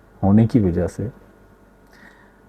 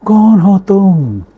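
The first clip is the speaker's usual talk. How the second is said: slow, high, loud echoing call; the words thrown back from far off; drawn-out echo from the mountains; 10 semitones higher, 7 dB louder, much slower than usual. Acted. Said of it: "आवाज़ गूंजती है पहाड़ों के दरमियान".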